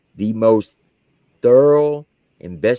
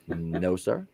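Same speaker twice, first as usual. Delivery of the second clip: quiet denial; 6 semitones lower, 11 dB quieter than usual. Retold or imitated. Lying to colonel.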